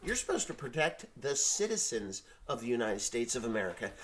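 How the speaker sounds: ordinary talk, nothing marked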